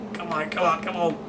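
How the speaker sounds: high, strained pleading to the car; excited urging. Real, not acted. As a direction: beg it through the pass. Urging a pass.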